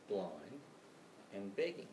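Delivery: ordinary talk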